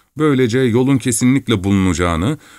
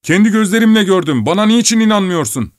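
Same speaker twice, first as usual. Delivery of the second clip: higher, louder indignant protest; exasperated driver; 11 semitones higher, 4 dB louder than usual. Acted. Driver Ignat protesting to the doubting miller, exasperated. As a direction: demand to be believed.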